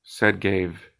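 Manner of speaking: plain reading voice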